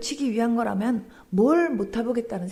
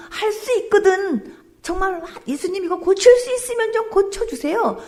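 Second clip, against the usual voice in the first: mock doubtful plea, much higher and louder; doubting father; 8 semitones higher, 5 dB louder than usual. Pastor mimicking the father's doubtful plea, higher and louder. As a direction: act out a hesitant, doubting father. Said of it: "이렇게 얘기했더니".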